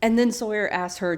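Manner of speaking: ordinary talk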